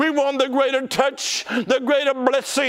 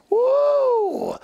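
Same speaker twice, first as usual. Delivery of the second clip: a sudden high "woo" of joy; high excited whoop; 14 semitones higher than usual. Real, not acted. Preacher whoops to the congregation in high excitement.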